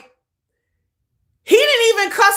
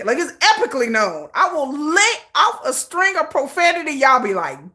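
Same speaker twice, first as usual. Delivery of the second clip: ordinary talk, nothing marked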